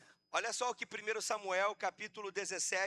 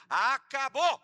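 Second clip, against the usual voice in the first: loud, raised-pitch declaration; emphatic shout; 4 semitones higher, 8 dB louder, much slower than usual. Acted. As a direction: shout ACABOU with a rising punch. Declares it over, then repeats it.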